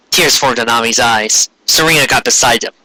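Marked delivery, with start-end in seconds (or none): none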